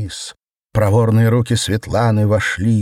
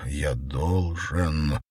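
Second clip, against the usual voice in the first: focused inner thought; 4 semitones lower, 6 dB quieter, much slower than usual. A narrator's reading. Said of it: "сосредоточенно думал он".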